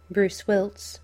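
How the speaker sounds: ordinary delivery, nothing marked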